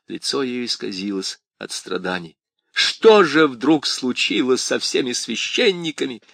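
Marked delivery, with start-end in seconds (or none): 2.7-6.2 hysterical shout. A narrator's reading. Крис в истерике кричит.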